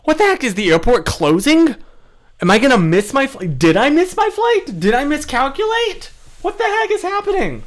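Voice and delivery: high, loud panicky rush; panicked. Retold or imitated. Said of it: "I was like".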